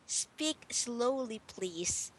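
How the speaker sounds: slow, careful teacher model; drawn out for the student to copy; slow model phrase. Shown as a demonstration. Teacher showing her student how to ask politely, slowly. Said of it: "then you can say to me".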